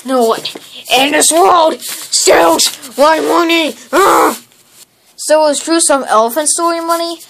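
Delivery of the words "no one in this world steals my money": slow menacing threat. Acted. Threat to the thief.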